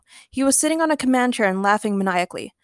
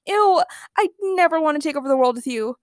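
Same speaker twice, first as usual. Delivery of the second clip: higher-pitched recoil of distaste; disgusted; 6 semitones higher than usual. A narrator's reading. Sierra rejecting the villain's plan, disgusted.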